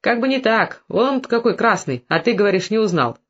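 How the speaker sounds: ordinary delivery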